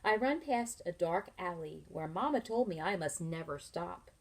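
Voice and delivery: ordinary delivery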